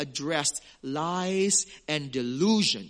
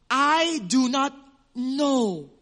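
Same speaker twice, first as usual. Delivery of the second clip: slow, louder preaching of Jesus's words; solemn declaration; 6 semitones higher, 5 dB louder, much slower than usual. Acted. A preacher booms Jesus's verdict to false disciples. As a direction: draw out each word with weight.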